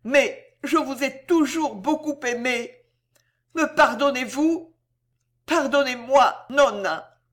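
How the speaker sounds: put-on high child's plea, lightly trembling; understated trembling child; trembling, effortful. A narrator's reading. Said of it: "d'une voix tremblante et avec effort".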